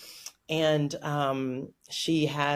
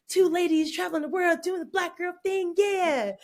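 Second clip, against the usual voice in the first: high, hyped-up cheer; excited fantasy. Retold or imitated. Traveler recalling fantasy with friend, mock-giddy excitement. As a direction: sing the praises of the dream, pitched way up.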